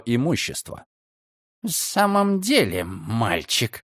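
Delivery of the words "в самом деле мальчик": put-on annoyed, hissed at the boy; irritated hiss. A narrator's reading. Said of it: "раздражённо прошипел старик".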